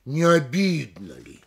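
slow, stretched ОБИДНО, then falling; question with falling tune. Shown as a demonstration. Lecturer demonstrating a question that asserts, slow falling tune. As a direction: lean on ОБИДНО and let ЛИ drop. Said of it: "я произнёс их с понижением".

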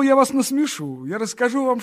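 выше и громче, с жаром; выпалить, предвкушая смех; оживлённо. Acted. Смущённо-оживлённое признание. (оживлённо, громче и выше)

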